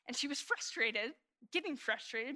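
normal talking voice

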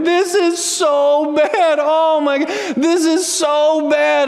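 put-on high, slow, drawn-out child's whine; whiny child. Daughter whining to herself in the other room, high and drawn out. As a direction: whine it like a four-year-old over a broken toy. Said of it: "she just whines".

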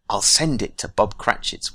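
ordinary delivery

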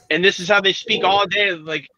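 ordinary talk

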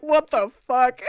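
mock stunned outrage; shocked. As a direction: recoil at the weird remark. Jenna's shocked reaction.

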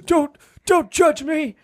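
put-on high, slow, laughing old-man voice; cartoon old principal, half laughing. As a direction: whine it like a grumpy old geezer. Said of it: "Why is he so old?".

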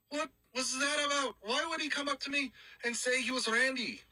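put-on high-pitched, indignant Irish accent. Roommate demands to know about Randy, indignant Irish accent. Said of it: "pretty good job with that accent".

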